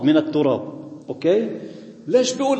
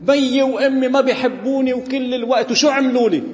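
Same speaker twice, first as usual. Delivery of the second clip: put-on higher-pitched teen grumbling about mom and dad; whining teenager; 6 semitones higher than usual. Teenager complaining to the pastor about parents, higher whiny voice. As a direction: play a sulky teen who feels unloved. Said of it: "بيجينا أولاد مثلًا أو شي هيك بالمراهقة".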